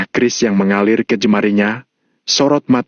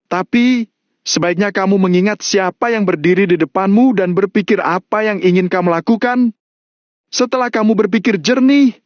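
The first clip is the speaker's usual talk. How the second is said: raised-pitch urgent warning; urgent shout; 8 semitones higher than usual. A narrator's reading. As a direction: appeal to him forcefully, voice climbing high. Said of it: "menatap Mario dengan wajah pucat dan berteriak".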